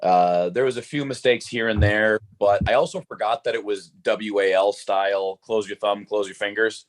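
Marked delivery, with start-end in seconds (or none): none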